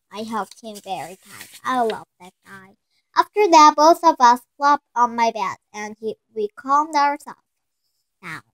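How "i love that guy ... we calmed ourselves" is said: ordinary delivery, nothing marked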